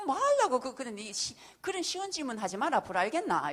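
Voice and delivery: high, loud telling-off; scolding son. Retold or imitated. Mother telling her son off for easy questions, loud and high. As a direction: shout down a cheeky question.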